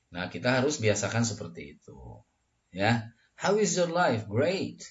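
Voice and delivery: ordinary delivery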